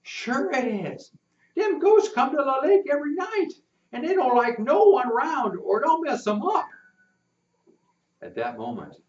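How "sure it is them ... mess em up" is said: put-on spooked boy. Rufus insists the lake is haunted, high and earnest. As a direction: tell it like a true secret.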